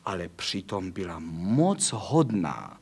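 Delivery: ordinary delivery, nothing marked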